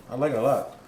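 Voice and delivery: talking while chewing a bite; mouth full. Real, not acted. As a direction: say it around a mouthful of food. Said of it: "shouldn't talk with my mouth full".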